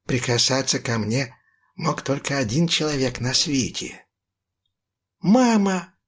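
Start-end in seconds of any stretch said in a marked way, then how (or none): 5.2-5.9 higher, louder cry for mother. A narrator's reading. Boy cries out to his mother in relief, higher and louder. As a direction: call for mum in relief. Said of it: "закричал я".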